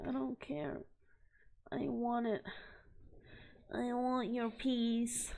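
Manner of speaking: soft, put-on covetous voice; quietly insist, acting out how badly you wanted it; retold insistent wanting. Retelling her insistence on trading.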